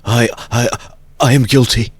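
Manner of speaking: loud, high stammering outburst; desperate confession. Acted. Nikolai kneeling before Porfiry, loud desperate confession. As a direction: blurt the guilt out in desperation.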